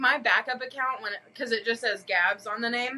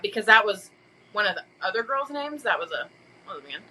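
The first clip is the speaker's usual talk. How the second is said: ordinary delivery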